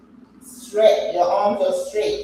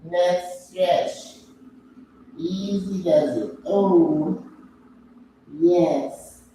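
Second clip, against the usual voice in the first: ordinary delivery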